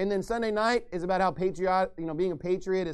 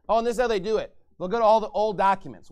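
ordinary talk, nothing marked

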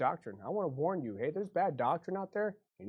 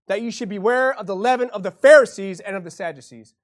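loud, raised warning cry; full-force preaching; 6 semitones higher, 11 dB louder than usual. Acted. Pastor warning his flock, shouting with love. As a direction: preach the warning out with all your voice.

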